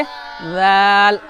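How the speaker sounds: राल drawn out very slowly, clear z sound; Arabic zaal. Shown as a demonstration. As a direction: stretch राल long and slow. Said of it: "कैसे?".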